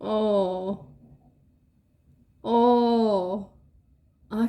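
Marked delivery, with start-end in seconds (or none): none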